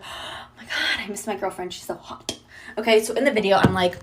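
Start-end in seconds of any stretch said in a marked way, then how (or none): 0.0-2.4 soft, breath-filled mock swoon; imagined swooning. Boyfriend waking up to her dress video, hushed swoon. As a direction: gush under your breath as the smitten boyfriend.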